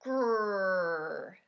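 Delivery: loud, low growl; menacing animal growl; rhino growl. A narrator's reading. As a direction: growl like a big grumpy rhino. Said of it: "said the rhinoceros".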